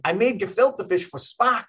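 hint of a New York accent when quoting the guy; mild New York accent imitation. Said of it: "he's got this thick New York accent".

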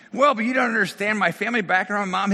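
lightly acted, insistent protest; mock protesting. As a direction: plead your case as the client. Client protests to the therapist.

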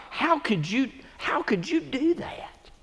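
high, soft, slow incredulous question; puzzled persecutor. Retold or imitated. The one who hurt you asks why, softly amazed. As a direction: ask it in soft disbelief. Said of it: "they ask you why".

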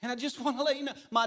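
high, loud preaching build; preaching at full force. Real, not acted. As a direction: rise into an urgent appeal. Preaching climax, rising intensity.

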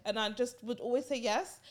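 ordinary talk, nothing marked

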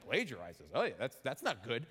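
mock a doubting listener, low; skeptical listener. Preacher imitating a doubtful listener, low and skeptical. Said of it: "you might go".

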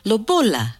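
ordinary delivery, nothing marked

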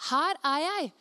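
acted reporting in; eager answering call. Samuel answers a third time.

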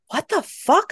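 high, startled narrated yell. A narrator's reading. Woman scratched by something unseen, yelling. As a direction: yell it in shocked pain, pitch leaping. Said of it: "I yelled".

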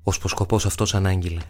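ordinary delivery, nothing marked

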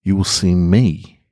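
calm, measured reading of a personal essay; story narration. Acted. Reads his reflective story.

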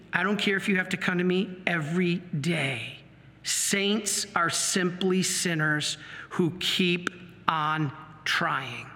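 measured words with pauses to sink in; solemn counsel. Retold or imitated. Quotes priest's solemn advice, slowly.

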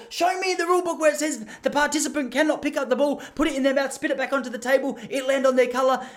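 high, loud indignant demand; indignant challenge. Retold or imitated. Uncle challenging the security guards, loud indignant demand. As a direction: dare them to find the rule, loud. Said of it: "He goes".

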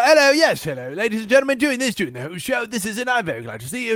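put-on high, lilting performer's accent; stagey Renaissance fair accent; showman's put-on accent. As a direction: greet the crowd in a theatrical fair accent. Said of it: "accent and doing everything".

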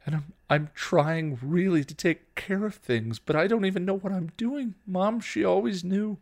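high, halting, distraught; tearful, rising desperation; starting to cry. A narrator's reading. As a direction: unravel as you explain, faltering. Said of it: "she started to cry".

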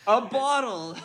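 loud, high echoed exclamation; mock yell. Joining co-host's bottle quote, mock yell.

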